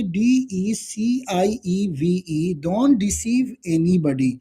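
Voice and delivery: very slow, word by word for notes; slow dictating teacher; slow dictation. Shown as a demonstration. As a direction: spell it out, then say it slowly. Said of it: "तो कैसे बोलोगे".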